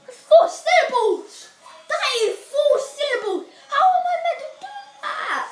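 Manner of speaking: high loud laughing protest; laughing dismay. Real, not acted. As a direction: laugh in mock despair. Laughing dismay at hard rhythm.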